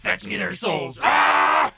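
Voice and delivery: loud shouted demon command. Acted. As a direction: roar it out with a scream. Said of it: "the demon thing".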